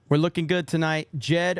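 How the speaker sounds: usual speaking voice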